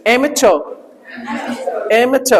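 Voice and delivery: "एम च" with च in place of ट; "च" sound. Shown as a demonstration. Teacher showing students the silent R, "एम च" ending in a soft च-uh. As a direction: say "एम च", the final र left silent. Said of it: "थोड़ा च की sound लेके आना है".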